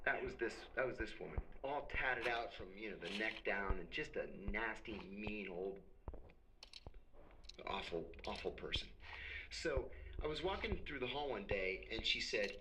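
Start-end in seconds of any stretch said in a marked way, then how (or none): none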